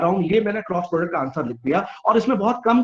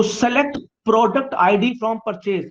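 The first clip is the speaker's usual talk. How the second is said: slow dictation; 3 semitones higher, much slower than usual. Shown as a demonstration. Teacher dictates the SQL query.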